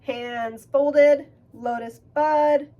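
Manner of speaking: rally the class to start; loud rallying call. Real, not acted. Calling start of the exercise.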